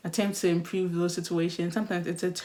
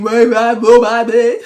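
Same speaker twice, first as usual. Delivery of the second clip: mock phone shout; 3 semitones higher, 13 dB louder, slower than usual. Mimicking a loud caller in a restaurant, shouting. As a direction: bellow it into the phone, hamming it up. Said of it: "you are shouting".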